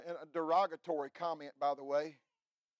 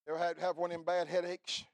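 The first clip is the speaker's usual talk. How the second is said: ordinary delivery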